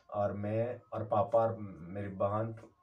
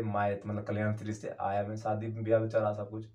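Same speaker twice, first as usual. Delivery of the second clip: usual speaking voice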